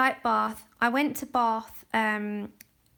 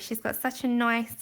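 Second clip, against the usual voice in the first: ordinary talk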